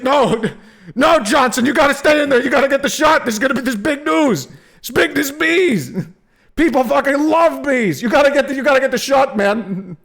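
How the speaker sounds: high, loud insistent boss voice; pushy news boss. Pretend. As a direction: order Johnson to stay and shoot. Boss orders photographer to stay.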